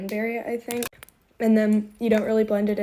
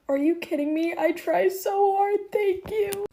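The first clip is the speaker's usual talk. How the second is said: touched disbelief; 10 semitones higher than usual. Retold or imitated. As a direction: gush back, rising in pitch as it lands. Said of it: "and I was like".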